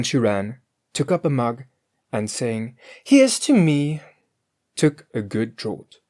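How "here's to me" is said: Grethel's toast. A narrator's reading.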